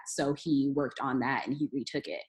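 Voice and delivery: ordinary talk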